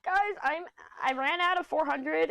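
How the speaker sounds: high mock complaint; high whiny plea to viewers; mock whine. Quoting her own complaint.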